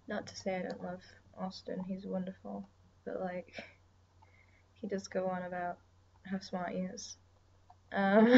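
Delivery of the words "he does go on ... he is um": ordinary delivery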